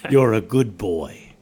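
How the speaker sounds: slow, deliberate put-on movie voice; Hugo Weaving. Headband recording praises its wearer, slow celebrity impression. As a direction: say it slowly in an imitated actor's voice. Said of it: "In that voice that everyone knows, undeniably, Hugo Weaving's voice".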